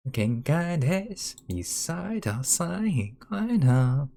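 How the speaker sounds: slow playful fake Japanese; sing-song faux Japanese words; slow mock-Japanese gibberish.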